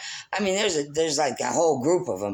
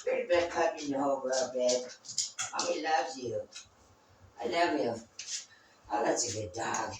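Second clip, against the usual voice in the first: soft, cooing pet talk; tender dog praise; 7 dB quieter than usual. Real, not acted. Owner softly praising her dog, tender and cooing. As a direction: murmur love to the puppy.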